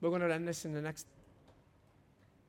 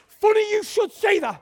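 high, loud triumphant reply; excited reply; 19 dB louder than usual. Retold or imitated. Preacher retelling his answer to the boss, loud and high. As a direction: burst out with it. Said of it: "I went".

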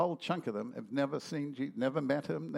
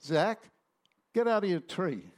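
calling out; 3 semitones higher, 5 dB louder than usual. Retold or imitated. Jesus calls man in tree.

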